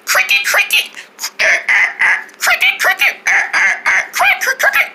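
"Cricket, cricket" chirped very high and thin; cricket noises. Acted. Mother cricket chirping to call her daughter, very high chirp. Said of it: "Say it with us".

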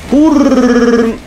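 high, drawn-out rolled "purrr"; trilled purr noise. Real, not acted. Gamer making noises while playing, trilled purr. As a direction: roll out a long high trill. Vocal noise during play.

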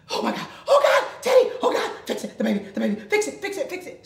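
high, loud panicked cry of oh God; frantic begging, half laughing; panicked shriek. Retold or imitated. Mother spotting her daughter on the stairs, panicked high shriek. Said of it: "I was like".

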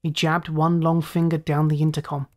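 usual speaking voice

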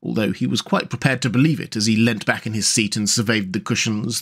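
ordinary delivery

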